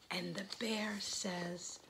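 whispered story narration; hushed whisper. A narrator's reading. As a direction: whisper it gently to little listeners. Hushed narration to a shy creature.

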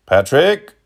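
loud, high call with rising end. A narrator's reading. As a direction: summon a servant, voice lifting at the end. Man calling for his servant.

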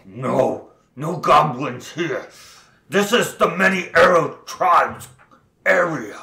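lightly voiced gruff goblin. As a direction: answer like a wary goblin.

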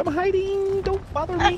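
squeaky high hiding voice; high mock voice. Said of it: "Carlos like".

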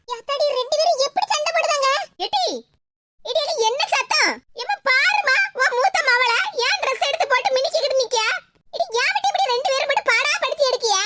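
a hint of a fed-up mother breaking up a fight; lightly voiced bickering family. High-voiced figures in family quarrel.

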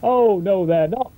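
hammed-up pantomime denial; panto heckle. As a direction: shout it like a panto audience. Pantomime call and response.

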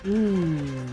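ordinary talk, nothing marked

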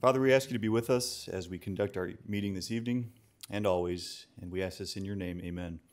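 ordinary talk, nothing marked

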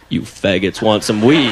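deep, slow dealer's pitch; gruff drug dealer. Retold or imitated. Dealer pitching weed to two passing guys, deep slow voice. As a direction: drop low and slow like a street dealer. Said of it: "he goes".

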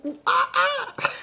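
monkey noise imitation.

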